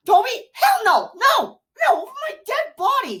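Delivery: high, loud disbelieving protest; outraged refusal. Real, not acted. Refuses to accept Toby's death.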